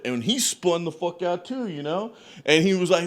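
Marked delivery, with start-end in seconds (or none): none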